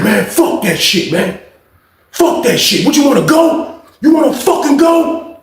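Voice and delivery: squaring up; mock fight challenge.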